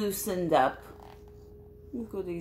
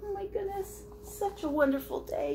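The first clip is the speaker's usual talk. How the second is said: a breathy little lemur gush; squeaky lemur; 14 semitones higher, faster than usual. Acted. Reading to kids, Crystal repeats the lemur line.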